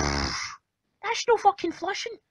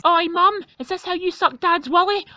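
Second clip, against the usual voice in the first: ordinary talk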